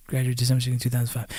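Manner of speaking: hushed, quick murmur under the breath; muttering to himself. Real, not acted. Speaker checking his graduation date to himself, muttering. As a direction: recall it quietly to yourself.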